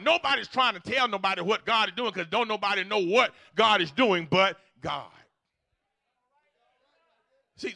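loud, high, rising preacher's call building in force; full-force preaching. Acted. Pastor to congregation on obeying the word, full-force preaching.

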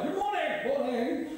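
a light mock-posh good morning; posh greeting. Villagers greet each other in a lightly posh voice. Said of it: "you find yourself talking differently".

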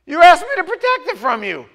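loud, high, pointed answer in God's voice; God calling him out, loud; God's reply. Retold or imitated. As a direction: hit back with God's blunt answer.

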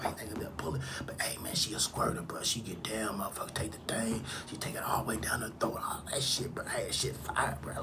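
secret bragging whisper; hushed whisper. Retold or imitated. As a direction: keep the brag low between friends.

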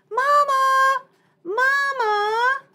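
high ringing call. Acted. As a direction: shout for Mama from afar. Bear calling for her mother.

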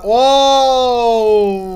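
loud excited cry; big surprised whoop. Real, not acted. Big fish appears, excited. (heyecanlı bağırış)

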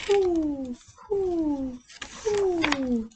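high, falling owl coos; owl call. Acted. A night owl calls over the river, high coos. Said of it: "A night owl calls".

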